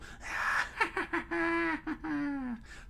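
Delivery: long, high villain cackle; hammed-up high evil laugh. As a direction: cackle with gloating glee. Villain laughs after his order.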